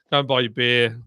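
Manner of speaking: ordinary delivery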